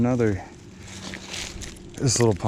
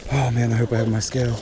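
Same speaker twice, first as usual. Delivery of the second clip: ordinary talk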